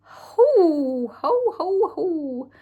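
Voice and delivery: loud high hooting exclamation; loud shocked hoo ho ho. Real, not acted. Fan recalling Infinity War shock, loud hooting. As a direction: blow out a loud shocked hoot. Reacting to surprise deaths.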